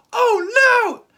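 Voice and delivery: high, loud, alarmed cry of no; panicked cry. Retold or imitated. Peter hearing Aunt May is in danger, a panicked cry. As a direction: shriek it in sudden panic. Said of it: "And he's like".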